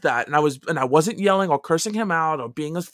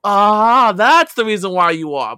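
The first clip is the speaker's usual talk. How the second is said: loud barking army yell, pitched up; retold drill yell; 6 semitones higher, 7 dB louder, slower than usual. Drill sergeant yelling at a fresh recruit, loud mock bark.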